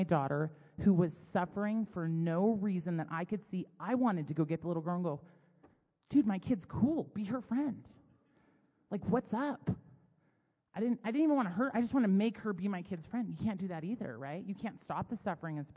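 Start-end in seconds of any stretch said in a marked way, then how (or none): 6.1-9.7 mock confrontation. Mom imagining confronting her daughter's friend, mock indignant plea. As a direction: playfully take a child to task for your kid.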